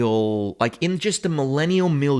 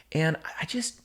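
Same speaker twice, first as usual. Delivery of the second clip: whisper; 4 semitones higher, 5 dB quieter than usual. Real, not acted. Whispered aside.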